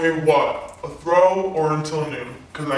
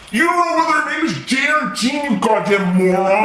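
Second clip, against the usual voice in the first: high-pitched furious accusation; angry taunting shout; 6 semitones higher, 3 dB louder than usual. Acted. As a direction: hurl insults while held down. Pinned boy keeps insulting his roommate.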